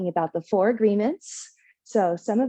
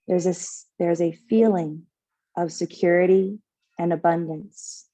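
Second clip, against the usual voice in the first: calm, measured meditation voice with long pauses; guided meditation; 2 semitones lower than usual. Real, not acted. Yoga teacher leading the class into rooting breath, meditative calm. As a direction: guide them gently into stillness, unhurried.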